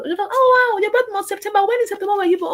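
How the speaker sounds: high delighted gush; mock-excited client chatter. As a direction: gush over the birthday news. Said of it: "I was".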